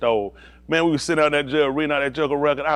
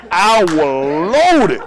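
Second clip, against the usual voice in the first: "loaded" hugely stretched and loud; exaggerated drawn-out emphasis; 2 semitones higher, 6 dB louder, much slower than usual. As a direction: drag "loaded" out very slowly for laughs. Repeats it, stretched further.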